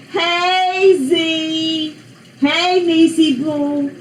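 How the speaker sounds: high, drawn-out sing-song greeting; calling out. Real, not acted. Aunt greets her three-year-old niece, calling out.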